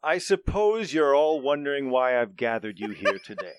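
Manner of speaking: loud, grand announcing voice; grand speech. Acted. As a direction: address the crowd like a boss. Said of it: "he says".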